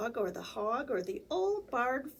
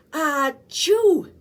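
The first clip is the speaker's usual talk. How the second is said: loud, higher achoo; very big sneeze; 6 semitones higher, 12 dB louder than usual. Acted. Hippo giving a very big sneeze, loud. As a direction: let rip a very big sneeze.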